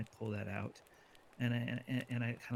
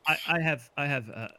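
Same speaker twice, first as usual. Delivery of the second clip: usual speaking voice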